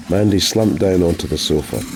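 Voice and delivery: plain reading voice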